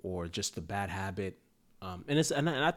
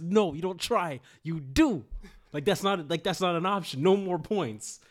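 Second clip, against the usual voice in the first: higher, louder coach laying down the law; emphatic scolding; 8 semitones higher, 5 dB louder than usual. Retold or imitated. Coach pokes player's chest, refusing "I'll try", emphatic scolding. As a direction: drive each point home, raised and forceful.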